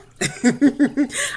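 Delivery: high loud laugh breaking out; real burst of laughter. Real, not acted. Telling the church her story, laughing out loud. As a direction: laugh out at the memory.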